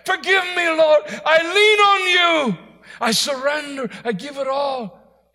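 anguished shouted surrender to God; strong crying. Acted. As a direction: wail it out high and loud, drawing out the words. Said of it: "supplication means strong crying".

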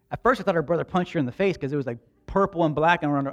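ordinary talk

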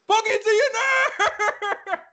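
laughing whispered yell; whisper scream. Acted. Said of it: "scream whisper".

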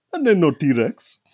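hammed-up Indian dad, drawn out and loud; Indian accent. Quoting the disbelieving dad for laughs, in Indian accent. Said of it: "an Indian accent".

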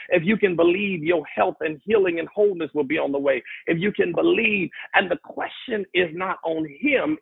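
high, insistent pulpit cadence; impassioned preaching. Real, not acted. Preacher urging the congregation to believe, impassioned and insistent. Preacher builds on repeated scripture.

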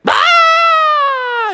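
very high mock scream; high, drawn-out comic scream; frightened troll scream. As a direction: shriek like a spooked troll. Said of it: "the troll was like".

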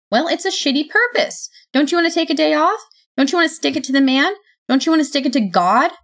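fast, high, loud goading. Acted. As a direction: egg them on, quick and pushy. Sophie goads Death.

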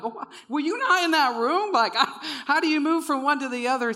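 high, loud, hammed-up disbelief; incredulous outburst. Resident retelling his reply to the coach, incredulous outburst. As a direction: burst out in baffled protest.

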